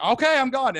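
high, loud, panicky backing off; acted-out nervous bail-out; hasty retreat. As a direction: squeak out a quick surrender. Acts out fleeing the big man.

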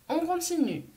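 normal talking voice

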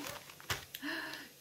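sharp delighted intake of breath; excited gasp. Real, not acted. Unwrapping a present for viewers, excited gasp. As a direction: gasp at the sight of a gift. Opening gift, sees kitchen towel.

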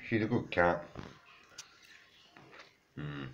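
usual speaking voice

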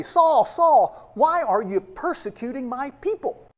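loud, high cry of the names; loud call. Acted. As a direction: call out Saul's name loudly, then the question. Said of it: "he doesn't say".

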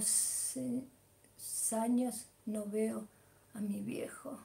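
soft whisper, fading out. Real, not acted. Telling viewers she misses her father, whispered. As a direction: barely breathe the words.

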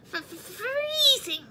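high, slow shivering stammer; freezing mouse stammering with cold; chattering teeth. A narrator's reading. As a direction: stutter it through chattering teeth. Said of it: "said Mouse with chattering teeth".

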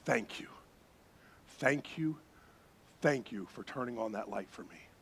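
soft, whispery thanks to the guide; quiet grateful murmur; hushed thanks. Retold or imitated. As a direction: thank him under your breath.

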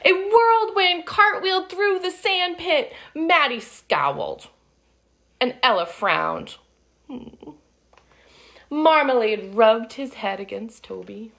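put-on lively read-aloud for kids; storybook narration. A narrator's reading. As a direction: tell it like a picture book to children. Reading picture book aloud.

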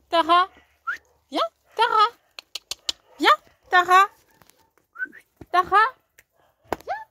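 loud, high calling to a dog. Real, not acted. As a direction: call the dog from across the yard.

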